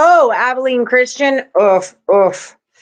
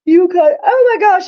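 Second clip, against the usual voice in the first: words stretched high by a real yawn; 10 semitones higher than usual. Real, not acted. Host yawning mid-pick, talking through it. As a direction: let a big yawn pull the words up. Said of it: "Sorry, I'm yawning".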